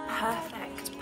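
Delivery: usual speaking voice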